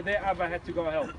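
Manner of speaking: normal talking voice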